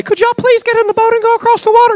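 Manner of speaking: high, far polite asking voice; polite request. Retold or imitated. Contrasting Jesus with an overly polite asker. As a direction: ask sweetly and politely. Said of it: "He didn't say".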